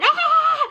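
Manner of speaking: high-pitched shriek; acted-out freak-out scream; re-enacted panicked scream. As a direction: let out a high squeal of horror. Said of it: "And I start going".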